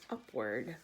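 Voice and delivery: usual speaking voice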